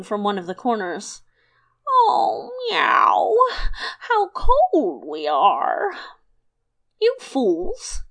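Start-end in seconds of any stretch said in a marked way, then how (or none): 1.9-5.9 meowing cry, then slow shivering moan; crying cats. 7.0-8.0 high, sharp shout.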